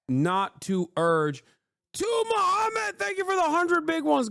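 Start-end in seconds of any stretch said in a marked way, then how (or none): 1.9-4.3 high, excited thanks. Real, not acted. Streamer thanking a donor on stream. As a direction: shout out the big donor.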